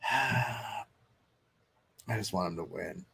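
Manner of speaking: sighs, then says it soft and slow; a quiet, slow, longing wish; wistful sigh. Real, not acted. Fan telling chat he hopes Silva wins, soft and slow with a sigh.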